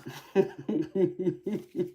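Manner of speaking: high-pitched real giggle; giggling laugh. Real, not acted. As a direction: giggle at your own little joke. Joking about her age.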